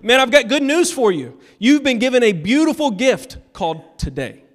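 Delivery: high, loud preacher's proclamation; impassioned preaching. Real, not acted. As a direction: proclaim good news to the congregation. Preaches with force.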